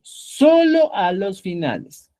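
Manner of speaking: slow, stressed repetition; teacher stressing to students that suffixes go only at the end, slowly; slow emphasis. Shown as a demonstration. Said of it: "A los finales, estimados amigos".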